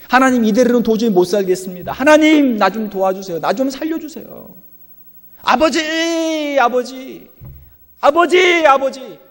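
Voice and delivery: high, loud crying out to God; wailing prayer. Acted. Preacher acts Hannah wailing out to God. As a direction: wail it like Hannah begging God. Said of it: "통곡하는 거예요".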